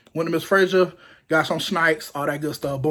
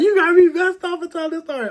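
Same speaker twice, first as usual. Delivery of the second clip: mock complaint; 12 semitones higher, 3 dB louder than usual. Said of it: "he gonna be like".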